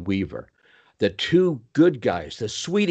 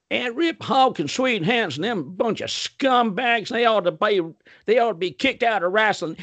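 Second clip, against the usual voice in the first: put-on North Carolina accent; 11 semitones higher than usual. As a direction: mimic angry North Carolina locals grumbling about wrestlers. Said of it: "And so I will do the accent as I'm sharing this story".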